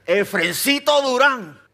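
mother's warning, full name called out slow, high and loud; stern full name. Retold or imitated. Mother switches to a warning tone, stern full name. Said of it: "Lucy cambió el tono".

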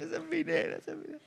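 high, very soft doting coo; tender mock-father voice, hushed and high; imitated fatherly affection. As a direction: imitate a proud dad, fading almost to nothing. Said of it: "Me dijo con ese cariño".

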